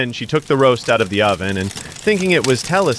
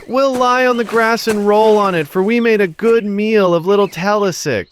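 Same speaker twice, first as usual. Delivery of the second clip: high, gloating chant; dragons gloating; 10 semitones higher than usual. Acted. Dragons gloating after the feast, high chant. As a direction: gloat over the meal. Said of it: "cried again".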